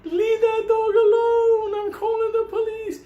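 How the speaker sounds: high, loud, slow outraged yell; mock screaming. Neighbors yelling at the man beating the dog, high mock scream. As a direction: screech at him to stop. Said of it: "People are screaming".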